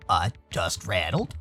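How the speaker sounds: low, slow, soft character voice; deep, quiet, careful delivery; deep-voiced friend. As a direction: drop low and slow, gently.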